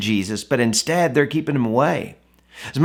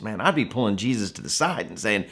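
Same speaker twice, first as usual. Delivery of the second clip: ordinary delivery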